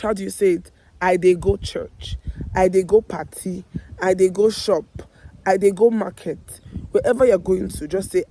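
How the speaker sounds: normal talking voice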